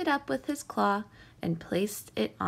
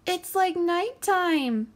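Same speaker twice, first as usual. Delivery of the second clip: loud, high, slow outcry; crying out; 9 semitones higher, 5 dB louder, slower than usual. A narrator's reading. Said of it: "cried the sea urchin".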